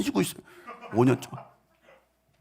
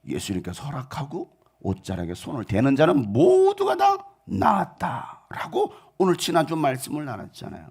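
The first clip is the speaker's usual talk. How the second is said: normal talking voice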